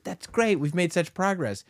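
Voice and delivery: high mock-cheerful praise; lightly hammed-up proud parent. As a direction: gush like a proud dad. Dad's mock proud reply.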